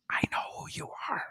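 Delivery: voiceless whisper into an ear; hushed, secretive imitated fan. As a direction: whisper it close, as if sharing a secret. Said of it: "whispers in my ear".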